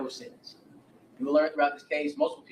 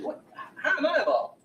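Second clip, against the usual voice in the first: higher, louder retold 'How am I involved?'; mock indignant surprise; 10 semitones higher, 4 dB louder than usual. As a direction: play her baffled protest. Brenda retold, confused protest.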